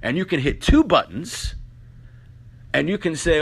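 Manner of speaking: usual speaking voice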